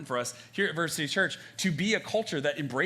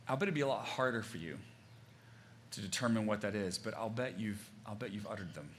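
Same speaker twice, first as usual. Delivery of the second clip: quiet and intimate; 4 semitones lower, 7 dB quieter than usual. Real, not acted. Preacher speaking softly about lasting words, quiet. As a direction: draw them in quietly.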